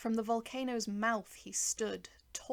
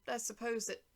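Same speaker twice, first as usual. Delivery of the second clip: ordinary delivery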